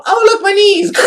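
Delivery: high, loud mock squeal; retold panicked thought. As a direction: squeal it as if flying mid-flip. Voicing thoughts mid-flip.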